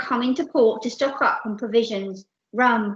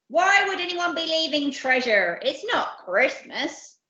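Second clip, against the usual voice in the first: raised pirate growl, slowing at the end; scornful pirate captain; 6 semitones higher than usual. Acted. Captain dismissing Percy's treasure idea, scornful growl. Captain scoffs after roaring.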